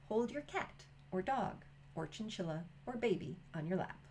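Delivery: ordinary delivery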